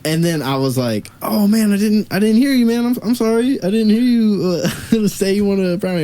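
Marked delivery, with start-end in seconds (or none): 1.2-6.0 high-pitched, pleading mock apology; apologetic. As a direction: act out an awkward excuse, voice way up. Retold apology excusing himself.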